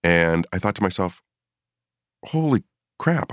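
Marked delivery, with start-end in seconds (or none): 2.3-3.3 sudden high gasp of disbelief; stunned. Retold or imitated. Retelling to host his shock at the UFO, high stunned blurt. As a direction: blurt it out, thunderstruck.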